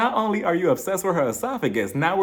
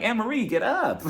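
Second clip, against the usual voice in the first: laughing cheer-on; 8 semitones higher than usual. Real, not acted. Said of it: "I'm like".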